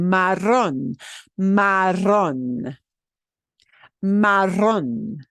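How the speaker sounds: marrón with trilled rr and the stress punched on rón; stress last syllable. Shown as a demonstration. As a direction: roll the rr and hit rón harder. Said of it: "punch that last syllable harder".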